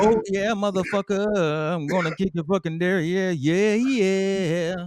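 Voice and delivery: high, slow, slurring "motherfucker"; belligerent drunk. Pretend. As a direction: slur threats like a bar drunk. Aggressive boozy rant in the skit.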